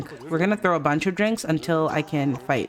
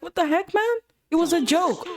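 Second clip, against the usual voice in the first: acted indignant protest; 10 semitones higher than usual. Voicing characters arguing at the party.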